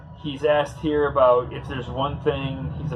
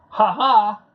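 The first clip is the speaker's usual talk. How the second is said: a short, loud, high mock laugh; 7 semitones higher, 4 dB louder than usual. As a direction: bark out a teasing laugh. Mock laugh at comic panel.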